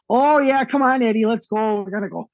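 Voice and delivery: lightly put-on Chicago accent; mock Chicago accent. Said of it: "Venom as a Chicago".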